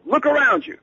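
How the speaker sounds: high, loud, brusque demand; high-pitched, loud impersonation of a famous singer on the phone. Retold or imitated. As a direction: bark it down the phone like a star. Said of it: "shouting so loudly".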